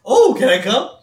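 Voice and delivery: high, loud mock eagerness; Homer. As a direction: blurt it out like an excited kid. Homer begs to join in.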